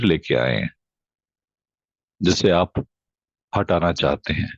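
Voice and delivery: low, slow meditation voice with long pauses; guided meditation. Real, not acted. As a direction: guide them slowly into deep, even breathing. Leads a breathing meditation.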